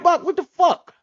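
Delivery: high, exasperated yelp. Retold or imitated. Retelling how he scolded Buck for gambling, high exasperated. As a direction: cry out in disbelief at a friend. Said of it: "I'm like".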